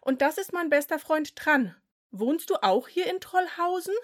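put-on cheerful kid; boy voice. A narrator's reading. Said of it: "antwortete er".

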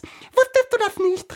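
very high, loud squeaky flower; piping little daisy voice; daisy calling out. A narrator's reading. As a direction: shriek it like a tiny flower. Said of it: "rief ein anderes Gänseblümchen".

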